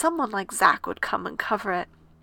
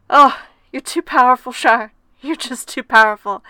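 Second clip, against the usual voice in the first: loud mock-awed teasing, louder; playful teasing; 8 dB louder than usual. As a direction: tease her like an old friend. Friend teasing about flamboyant dress.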